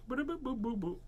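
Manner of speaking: high, soft little boops; light high-pitched sound effect; playful boop noises. Pretend. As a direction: boop along as the specs go on. Noises while putting on specs.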